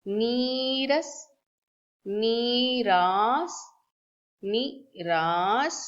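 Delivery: slow, stretched नीरास with a long second a; slow demo of the wrong spelling नीरास; misspelling drawn out. Shown as a demonstration. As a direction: drag out नीरास to show the wrong vowel. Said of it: "wrong spelling".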